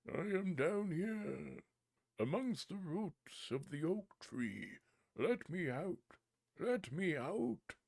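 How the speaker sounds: very high, slow, far-off little voice; tiny trapped spirit. Acted. Spirit in the bottle begging the boy, very high and slow.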